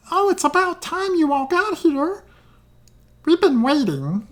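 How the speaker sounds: very high-pitched put-on gnome voice; gnomish woman ambassador. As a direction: scold the late guests in a high character voice. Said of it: "I'm trying to do voices".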